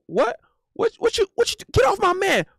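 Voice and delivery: put-on high-pitched indignant girlfriend voice; mock angry girlfriend. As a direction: play a jealous girlfriend squaring up. Acts out girlfriend defending her man.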